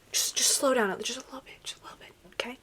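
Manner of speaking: tiny whispered plea; pleading whisper. Pretend. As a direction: whisper it as a gentle, mock-polite request. Pleading with fast video to slow.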